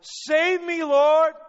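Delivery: very high, full-force scream for rescue; desperate shout. Acted. Preacher as sinking Peter to Jesus, desperate shout. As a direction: scream it out as if going under. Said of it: "he shouted".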